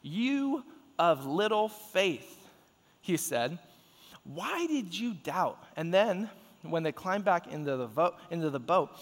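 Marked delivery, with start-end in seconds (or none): 0.0-2.5 slow, stretched-out scripture quote; drawn-out rebuke. A narrator's reading. Reads Jesus' rebuke of Peter. 4.2-5.6 slow, stretched-out scripture question; drawn-out reproachful question. A narrator's reading. Reads Jesus' question to Peter.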